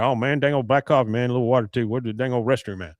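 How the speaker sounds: rapid-fire rambling order; Boomhauer. Acted. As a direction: rattle it off in one quick run. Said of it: "Slow it down, sweetheart".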